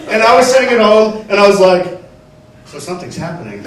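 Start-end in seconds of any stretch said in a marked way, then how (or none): 2.7-3.6 low and quiet, sensing trouble; ominous hushed voice. Retold or imitated. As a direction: breathe it like a dark premonition.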